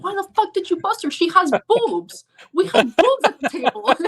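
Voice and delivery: put-on high, loud Russian accent rant. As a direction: yell in a thick Russian accent. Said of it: "in this thick Russian accent".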